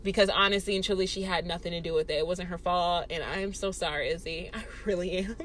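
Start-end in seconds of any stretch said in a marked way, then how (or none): 3.1-5.4 soft, lowered, remorseful voice; sincere apology. Real, not acted. Speaker owning up that her friend wasn't at fault, quiet sincere apology. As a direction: drop the volume and mean it.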